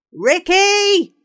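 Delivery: loud, high call across the square. Acted. Teacher cups hands and calls for Ricky, loud call. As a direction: shout the boy's name to find him. Teacher calling for missing boy.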